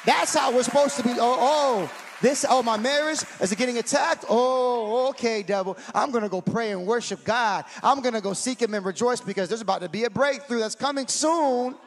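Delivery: loud, exclaiming church preacher; fired-up preaching. Real, not acted. Pastor rallying the church against the devil, fired-up preaching. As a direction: preach it with rising fervor and punched words.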